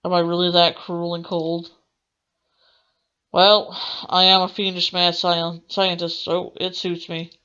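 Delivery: ordinary talk, nothing marked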